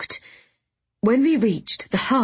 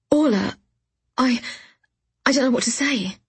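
higher, halting and overwhelmed; stammering; 5 semitones higher than usual. A narrator's reading. Rattled by Orla's startling offer, stammering. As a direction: grope for words with gaps between them. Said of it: "I stammered out".

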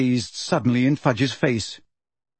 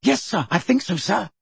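higher, eager underling; 5 semitones higher than usual. A narrator's reading. Percy answering Fudge on his notes, eagerly. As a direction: answer eagerly, keen to please. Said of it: "said Percy eagerly".

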